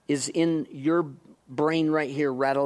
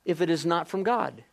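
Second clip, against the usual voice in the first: usual speaking voice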